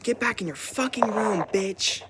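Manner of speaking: high, loud mocking yell at her mother; over-the-top imitation of a raging mom; exaggerated mom yell. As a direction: screech it like a cartoon angry mom. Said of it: "she's not saying it in this tone".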